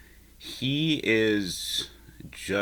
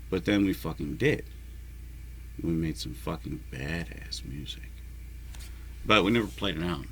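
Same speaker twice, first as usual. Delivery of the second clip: normal talking voice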